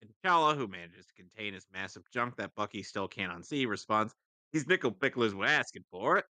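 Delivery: ordinary talk, nothing marked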